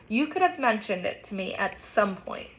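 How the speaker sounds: plain reading voice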